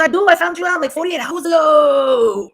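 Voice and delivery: high mocking holler, last words drawn out; mock ignorant. She mimics the woman answering Dre, mock ignorant. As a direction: imitate her loudly, stretching the ending. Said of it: "She sounded very in-, ignorant".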